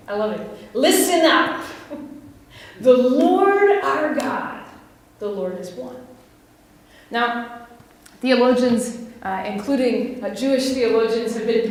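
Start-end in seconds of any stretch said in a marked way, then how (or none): 0.7-6.0 solemn proclamation. Pretend. Proclaiming the Shema.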